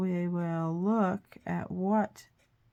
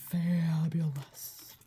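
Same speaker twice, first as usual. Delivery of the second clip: whispering; much slower than usual. Real, not acted. Whispered admiration of result.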